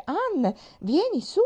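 high, slow call across the room; Mary calls Ann to the window, slow high shout. A narrator's reading. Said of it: "gridò Mary".

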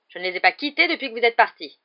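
high, loud testy retort; insist, thoroughly put out; exasperated. A narrator's reading. Matron saying she never left the students, exasperated. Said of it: "répliqua madame Pomfrey exaspérée".

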